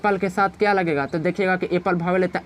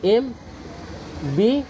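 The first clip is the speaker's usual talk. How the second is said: letter by letter, with long pauses; teacher spelling it out slowly for the students, slow; spelled out slowly; 3 semitones higher, much slower than usual. Shown as a demonstration. एम and बी said as separate letters, each held apart. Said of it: "तो देखिए एम कैसे लिखते हैं".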